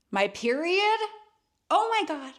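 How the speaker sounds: high, loud mock disbelief; scandalized retold outburst; incredulous. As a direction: replay the shock, pitched right up. Her acted shocked reply.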